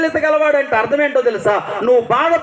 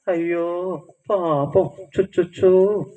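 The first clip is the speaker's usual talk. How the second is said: mock pity; 5 semitones lower, 3 dB quieter, slower than usual. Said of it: "అని కుక్కలు పిలిచేస్తున్నారు".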